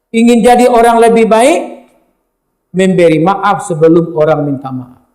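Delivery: ordinary talk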